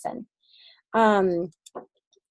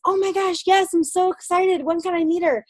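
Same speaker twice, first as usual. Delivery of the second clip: higher, gushing yes; eager excitement; 8 semitones higher than usual. Retold or imitated. The ideal upline's answer to a new recruit, in eager gushing excitement. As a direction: show the reply you hope for.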